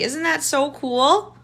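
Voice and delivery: ordinary talk, nothing marked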